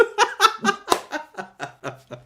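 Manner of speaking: high peal of laughter tailing off; real laugh. Real, not acted. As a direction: burst out laughing at your own story. Laughs at the couple splitting up.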